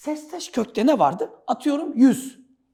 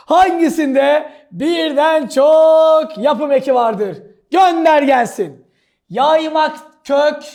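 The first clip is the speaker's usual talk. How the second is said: loud, slow, higher proclamation; booming announcement; 4 semitones higher, 8 dB louder, slower than usual. Acted. Teacher reads the exam question aloud, booming drawn-out delivery. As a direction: boom out each word, stretching it.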